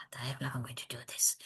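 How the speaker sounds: fast whispered panic; panicked whisper. Retold or imitated. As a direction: hiss it under your breath in dread. Her panicked inner thought.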